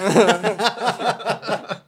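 very high, drawn out, laughing tease; teasing a friend about a fan's mix-up, drawn out; teasing drawl. Real, not acted. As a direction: stretch the name out slowly to needle him.

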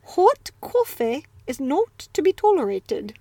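a light try at a British accent, rounded vowels; host practising a British accent sentence for listeners. Shown as a demonstration. Hint of a British accent. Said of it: "how to speak with a British accent".

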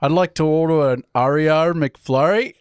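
hammed-up Australian vowels, bent out of shape; terrible Australian accent. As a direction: badly imitate an Australian ordering. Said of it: "This is my terrible Australian accent".

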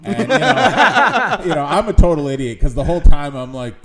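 normal talking voice

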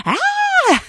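high mock scream of fright; shrill startled yelp; startled shriek. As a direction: squeal like someone who saw a rat. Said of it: "people are like".

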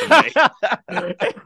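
laughing hard at the joke. Real, not acted. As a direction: crack up on the punchline.

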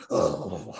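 low, short mumbled "Oh"; low grunt. Retold or imitated. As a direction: drop way down for a curt, bored "Oh". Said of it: "like that".

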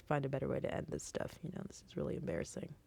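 whispered, fast, sheepish mutter; quick whispered embarrassed aside. Real, not acted. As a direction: rush it under your breath. Awkward ending muttered.

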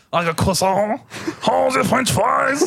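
high, hammed-up French accent; mock French accent. Echoing a cohost's croissant, mock French accent. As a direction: put on a silly French accent, drawn out and high.

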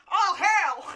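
loud surprised yelp from offstage; startled shout. Acted. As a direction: shout it from the back room. Ernie answers from the back.

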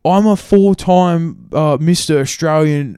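slow, loud, put-on bragging; boastful. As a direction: puff up and brag about titles. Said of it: "and then goes".

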